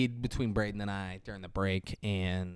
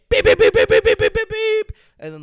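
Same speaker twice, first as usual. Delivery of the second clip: high, loud beep-beep horn noises; imitated horn beeps; 11 dB louder than usual. Mocking Mark Wahlberg's horn chasing her car.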